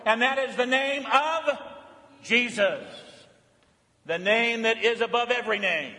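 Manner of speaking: fervent preacher lifting the praise; preaching. Acted. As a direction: proclaim it to the whole church. Preaching at full pitch.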